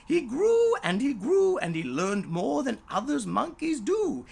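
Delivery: proud rising storyteller; triumphant build. A narrator's reading. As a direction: swell with pride. Triumphant growing.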